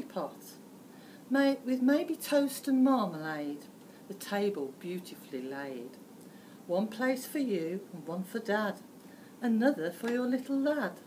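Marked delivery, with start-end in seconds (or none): none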